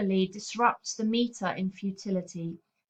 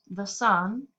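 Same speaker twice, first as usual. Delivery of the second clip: "the sun" with the weight on "sun", "the" light; stress on sun. Shown as a demonstration. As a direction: iambic reading of "the sun". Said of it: "where the second syllable is stressed".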